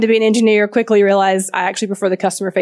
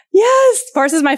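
delighted gasp; 11 semitones higher, 3 dB louder, slower than usual. Real, not acted. Audience recognizes her old company.

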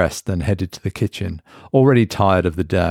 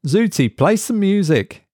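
Mark's raised voice calling across the bathroom; 12 semitones higher than usual. A narrator's reading. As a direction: call it out through the toothbrush. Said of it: "he called out".

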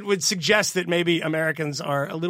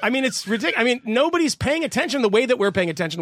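higher and louder, laughing through it; excited rant; 9 semitones higher, 5 dB louder than usual. Real, not acted. Ranting to cohosts about WikiLeaks, excited and laughing. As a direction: get worked up, swearing, amused.

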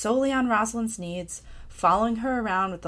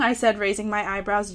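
ordinary talk

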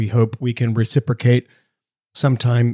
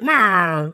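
drawn-out cartoon gangster "wah", pitched up; Jimmy Cagney; 5 semitones higher than usual. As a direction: squawk it like a Looney Tunes tough guy. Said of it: "What accent is it?".

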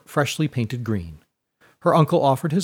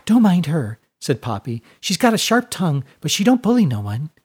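put-on higher, louder girlish gossip; high chatty girl; 5 semitones higher, 3 dB louder than usual. A narrator's reading. Poppy reassuring Fiona, high and chatty. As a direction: bubble along brightly. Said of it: "high, breathy voice".

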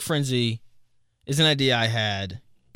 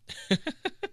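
breathless giggle; 7 dB quieter than usual. Real, not acted. Laughs at favorite comment.

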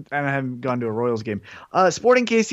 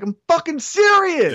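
insistent fan; 13 semitones higher than usual. Retold or imitated. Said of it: "I was like".

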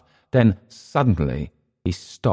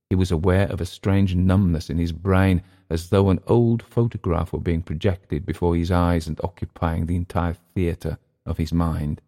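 usual speaking voice

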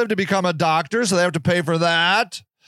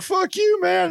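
a lightly voiced king, pitched much higher; mock king retort; 10 semitones higher than usual. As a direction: toss it back as a snotty king. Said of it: "the king's like".

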